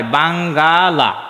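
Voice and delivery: বাঙ্গালা very slow, ba-ng-ga-la; old form Bangala. Shown as a demonstration. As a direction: draw out বাঙ্গালা syllable by syllable. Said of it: "বাংলা নয়". (বাঙ্গালা টেনে উচ্চারণ)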